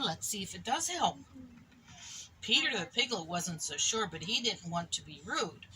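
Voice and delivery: ordinary delivery, nothing marked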